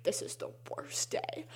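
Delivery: hushed whispered mock misery; whispered despair. Teen on the morning bus to himself, whispered mock despair. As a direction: breathe it out as a doomed whisper. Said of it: "being like".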